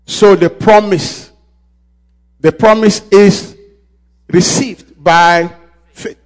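slow, loud, high preacher's cadence; spaced out sermon emphasis; preaching at full force. Real, not acted. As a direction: drive it home to the church.

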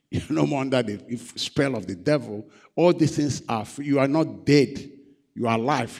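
ordinary talk, nothing marked

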